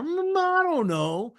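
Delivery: high, drawn-out unsure customer; hesitant customer. Acted. Salesman acting out a customer torn on the Broadmoor, hesitant and slow. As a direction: stretch it out, wavering and unsure.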